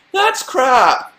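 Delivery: high-pitched mock outburst; exaggerated high jeer; mock heckling. Imitating hecklers judging the accent.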